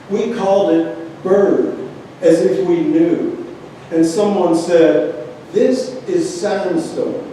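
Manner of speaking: measured free verse with pauses between images; poem reading. Acted. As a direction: recite each line as its own phrase. Reads a poem aloud.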